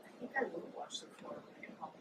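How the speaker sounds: fast soft whisper; hushed inner resolution; whispered thought. Retold or imitated. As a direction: whisper it quickly to yourself.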